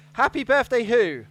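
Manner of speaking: loud game show host announcement; big game show host voice; quiz host. Pretend.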